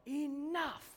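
high-pitched retold outburst; sudden yell. Dad yelling at his dogs without warning, sudden yell. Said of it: "I just yell".

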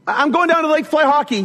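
high, fast, called-out announcement; son yelling upstairs. Retold or imitated. Son telling dad his plans from downstairs, calling out. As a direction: imitate a kid calling up the stairs. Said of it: "my son yells upstairs".

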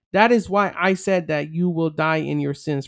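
usual speaking voice

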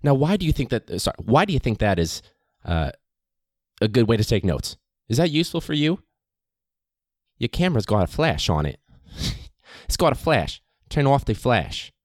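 mock-stern lecturer calling out the flash; scolding professor. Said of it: "she just turns to her and she goes".

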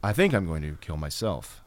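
low, hushed deadpan impersonation; odd inflections. Acted. Improving on the Breen impression, oddly inflected. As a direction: mimic a stilted amateur actor, deep and quiet. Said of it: "is to have odd inflections".

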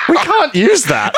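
laughing through a posh protest. Retold or imitated. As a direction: say it while cracking up. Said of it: "Moss is like".

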